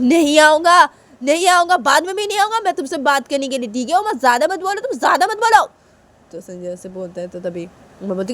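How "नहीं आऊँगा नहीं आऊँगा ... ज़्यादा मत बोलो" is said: put-on high, loud childish retort; acted sibling squabble; defiant refusal. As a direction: refuse hotly, voice pitched right up. Sanju refuses in quarrel.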